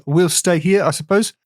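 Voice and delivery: tentative mock New Zealand accent; struggling New Zealand accent. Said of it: "struggle with a New Zealand accent".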